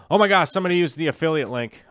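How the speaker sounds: suddenly thrilled, rushing the words; excited. Real, not acted. Sees a viewer used his link.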